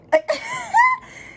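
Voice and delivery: very high burst of laughter; high squealing laugh; cackle. Real, not acted. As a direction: shriek with laughter at the hissy fit. Laughs at hissy fit.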